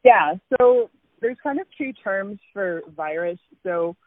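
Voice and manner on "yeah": ordinary talk, nothing marked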